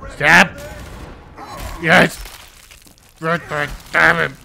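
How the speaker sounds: loud gleeful commands; excited yelling. Real, not acted. Gamer urging Redburn to stab, loud and excited. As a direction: bark it at the screen.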